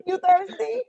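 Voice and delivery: high loud teasing question; teasing shout. Acted. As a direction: tease him loudly. Teasing the flirting trainer.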